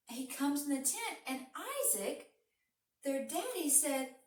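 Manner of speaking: ordinary delivery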